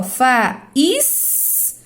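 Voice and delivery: "faísca" very slowly as fa, ÍS, ca; split hiatus. Shown as a demonstration. Teacher giving the same case, faísca split into slow syllables. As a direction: sound out faísca, separating and stretching ÍS. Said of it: "Mesmo caso de faísca".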